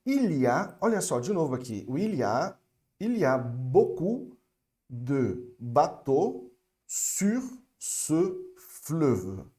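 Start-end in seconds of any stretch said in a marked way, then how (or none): 3.0-9.5 very slow French demo. Shown as a demonstration. Teacher asking students to repeat along, very slow. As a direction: stretch each word out very slowly. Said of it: "acompanha aqui comigo".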